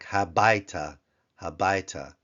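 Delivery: habaita with a clear open ha; habaita, open ah vowels brought out; bring out the patak. Shown as a demonstration. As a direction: habaita drilled for students. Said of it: "to bring out the patak".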